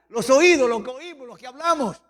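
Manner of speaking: normal talking voice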